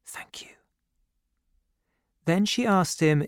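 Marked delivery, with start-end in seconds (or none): 0.0-0.5 barely voiced whisper. A narrator's reading. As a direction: whisper it very softly to a husband in bed. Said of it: "she whispered".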